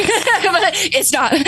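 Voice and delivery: sheepish laugh at the confession. Real, not acted. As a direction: laugh it out slowly. Admits she did not pray.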